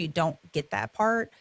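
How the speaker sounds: ordinary talk, nothing marked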